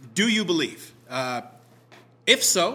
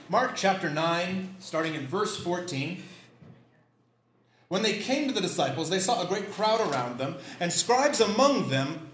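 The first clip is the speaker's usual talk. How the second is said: ordinary talk, nothing marked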